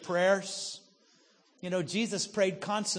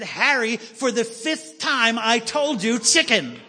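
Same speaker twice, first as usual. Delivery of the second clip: louder, higher, fed-up yell at her husband; exasperated shout; 4 semitones higher, 7 dB louder than usual. Retold or imitated. Wife shouts the answer at her husband, exasperated. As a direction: snap it out, fed up with repeating. Wife snaps after fifth asking.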